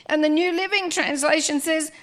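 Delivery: usual speaking voice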